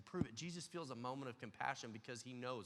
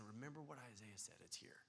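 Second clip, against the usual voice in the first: much softer, hushed reply; gentle soft answer; 3 semitones lower, 10 dB quieter than usual. Retold or imitated. Jesus gently answering John, much softer. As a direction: answer him quietly and tenderly. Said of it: "And Jesus is saying".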